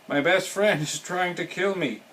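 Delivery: high-pitched storybook protest; crying out. A narrator's reading. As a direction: cry it out as an alarmed toad. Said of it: "cried Toad".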